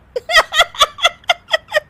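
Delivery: high, long helpless cackle; laughing fit. Acted. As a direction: burst into uncontrollable laughter. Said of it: "Anshu फिर से हंसने लगती है". (ज़ोर की बेकाबू हंसी)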